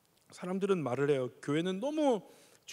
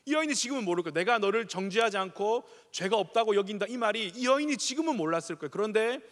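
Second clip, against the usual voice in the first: higher, louder pulpit appeal; fervent preaching; 4 semitones higher, 4 dB louder than usual. Acted. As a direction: build the sermon's climax with conviction. Preaching climax.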